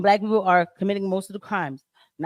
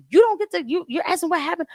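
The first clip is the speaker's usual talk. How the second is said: high-pitched imitated yelling, much higher; mock angry rant; 11 semitones higher than usual. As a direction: mimic her ranting at you, pitched way up. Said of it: "this broad is really yelling at me".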